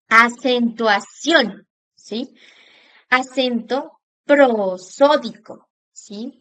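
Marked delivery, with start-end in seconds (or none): 0.1-1.7 Acentuación drawn out, weight on -ción; stress on final syllable. Shown as a demonstration. Teacher demonstrating vocal force to students, stress on -ción. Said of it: "hago mayor entonación al vocalizar la palabra". 3.1-5.7 prosódico slowed, with force on SÓ; stressed syllable demo. Shown as a demonstration. Teacher showing students where the force falls, stressed prosódico. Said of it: "Miramos que hay mayor fuerza".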